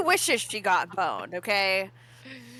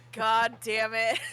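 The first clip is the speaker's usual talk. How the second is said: ordinary talk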